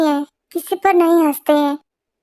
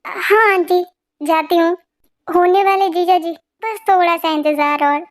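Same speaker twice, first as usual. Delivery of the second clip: usual speaking voice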